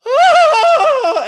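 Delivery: a hammed-up shriek, very high and loud; a melodramatic shriek flung to the heavens; shrieking wail. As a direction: let out a theatrical, laughing wail. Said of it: "She shrieks and wails and goes".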